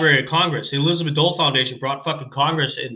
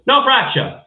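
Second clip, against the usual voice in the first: doctor voice; 8 semitones higher, slower than usual. Retold or imitated. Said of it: "I go".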